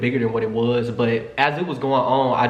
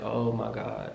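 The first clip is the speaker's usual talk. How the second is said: exasperated mutter; 3 semitones lower, 11 dB quieter, much slower than usual. Retold or imitated. He plops down beside her.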